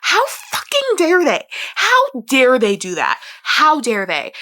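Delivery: high, loud indignant rant, fading down; outraged. Retold or imitated. Reader raging at the authors over a character's fate, indignant. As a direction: rant at the authors, starting high then settling. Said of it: "I would literally was like".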